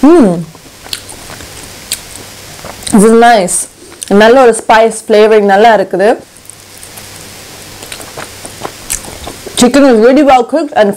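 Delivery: talking between bites; words muffled by food; mouth full, chewing. Real, not acted. Seen 2: Holds chicken at mouth, jaw moving, eating throughout the clip.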